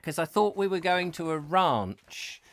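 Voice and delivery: ordinary delivery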